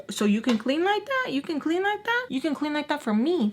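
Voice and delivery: high, incredulous rising questions; demanding grandma. Retold or imitated. Grandma questioning pregnant granddaughter about cleaning, high and affronted.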